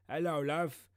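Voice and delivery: high, loud hammed-up Australian accent; over-the-top Australian impression; mock Australian accent. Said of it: "get you a Aussie".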